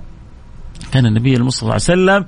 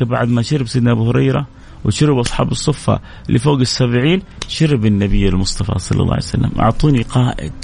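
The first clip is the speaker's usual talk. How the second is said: usual speaking voice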